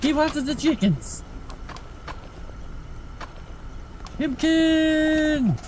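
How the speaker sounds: high squeaky pet talk. Real, not acted. Owner teasing his cat in high baby talk.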